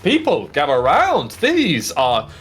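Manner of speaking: booming proud herald calling the crowd; dwarf herald announcing. Acted. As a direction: announce the champions with pomp.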